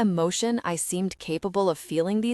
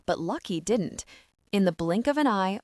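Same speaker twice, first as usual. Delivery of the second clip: usual speaking voice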